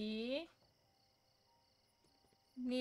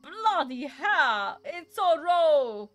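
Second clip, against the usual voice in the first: slow, loud, higher British chef yell; angry British chef shout; 5 semitones higher, 12 dB louder, much slower than usual. Retold or imitated. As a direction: bellow it like a furious TV chef. Gordon Ramsay impression, shouted. (lassú, hangos, dühös brit séf kiáltás)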